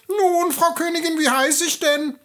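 very high, needling little goblin; nachhakendes Männlein. A narrator's reading. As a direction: prod the queen in a squeaky little-man voice. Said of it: "und nachhakte". (hohes Männchen, hakt nach)

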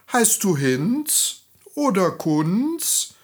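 schelmisch. A narrator's reading. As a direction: feign guessing, drawing out each name. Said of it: "fragte die Königin erst einmal schelmisch". (schelmisch und langsam fragend)

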